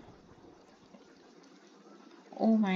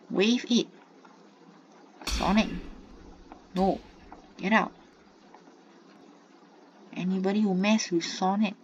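ordinary delivery

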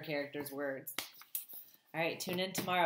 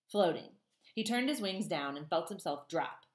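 ordinary delivery

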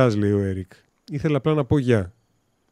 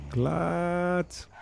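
mock sound effect; 6 semitones higher, 7 dB quieter than usual. Onomatopoeia for the click.

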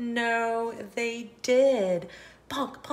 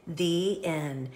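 usual speaking voice

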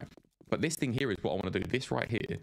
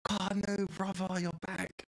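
excited; 9 semitones higher, slower than usual. Real, not acted. Excited greeting of friend back.

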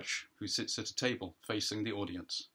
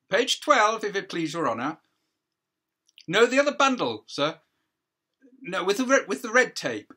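higher, louder, politely insistent counsel; counsel correcting the judge; 9 semitones higher, 10 dB louder than usual. Acted. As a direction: steer the judge to the right page.

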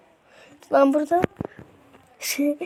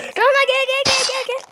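calling out; 12 semitones higher than usual. Real, not acted. Calling friend over.

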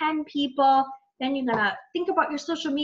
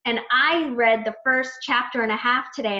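ordinary talk

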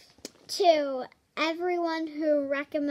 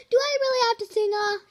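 fast, high, louder whine; rushed protest; 7 semitones higher, 7 dB louder, much faster than usual. Acted. Reader told to sing a song, fast panicked protest.

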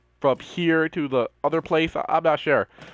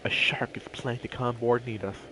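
spooky whispered warning; whispering; 4 semitones lower, 7 dB quieter than usual. Pretend. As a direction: whisper like a ghost story. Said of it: "Somewhere out there".

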